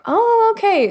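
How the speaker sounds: high, drawn-out "oh"; dawning realization. Acted. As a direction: act out the penny dropping. Voicing friend's dawning realization.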